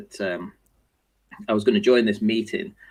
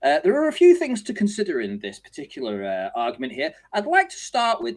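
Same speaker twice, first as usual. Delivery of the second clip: high, self-important opening statement; mock the know-it-all launching in; lightly voiced witness starting a speech; pompous Tony; 7 semitones higher than usual. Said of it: "So Tony goes".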